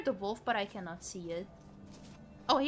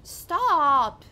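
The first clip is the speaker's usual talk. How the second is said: yelling; 5 semitones higher, 13 dB louder than usual. Real, not acted. Yelling at the game.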